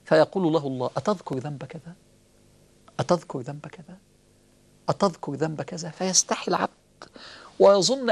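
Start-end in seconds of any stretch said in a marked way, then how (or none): 1.0-5.9 lower, softer, intimate call; voicing God, low and soft. Preacher voicing God calling the servant near, low and soft. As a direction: draw the servant close gently.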